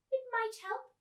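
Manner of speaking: put-on high old lady; old woman giving the girl a stone. Miss Spink, high voice.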